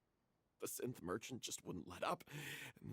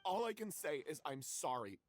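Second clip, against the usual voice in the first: ordinary delivery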